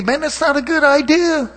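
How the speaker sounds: a hint of mock concern; friendly warning. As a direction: playfully warn a friend off a bad idea. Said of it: "I say".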